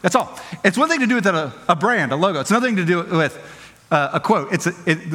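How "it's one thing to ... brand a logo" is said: ordinary talk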